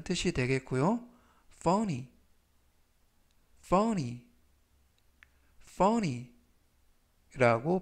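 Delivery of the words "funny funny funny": section said slowly and clearly; slow model pronunciation. Shown as a demonstration. Teacher reading vocabulary aloud for review, slow and clear. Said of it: "제가 천천히 세 번씩 읽어드리고요".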